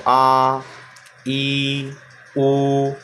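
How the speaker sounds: each long vowel आ, ई, ऊ held out fully; drawn-out vowel drill for students; long vowels demo. Shown as a demonstration. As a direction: stretch आ, ई and ऊ as a teacher's model. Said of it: "आप बोल करके देखिए इनको".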